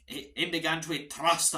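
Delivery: Scottish accent fan chant. Shown as a demonstration. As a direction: roll it out like a proud Scot, Scottish accent. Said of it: "in a Scottish accent".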